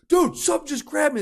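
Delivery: high, loud mock alarm; startled player. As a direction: blurt it out like a spooked gamer. Acted quote of panicked players.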